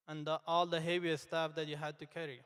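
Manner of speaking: normal talking voice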